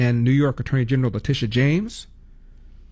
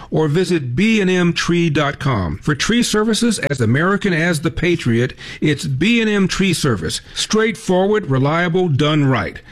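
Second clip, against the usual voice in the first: upbeat, louder, higher pitched ad pitch; radio ad read; 5 semitones higher, 4 dB louder than usual. Acted. Host reading a sponsor ad to listeners, upbeat. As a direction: sell the local tree service like a spokesman.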